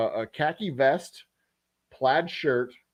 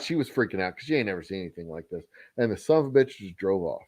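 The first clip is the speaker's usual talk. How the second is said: normal talking voice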